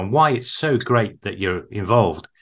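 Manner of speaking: normal talking voice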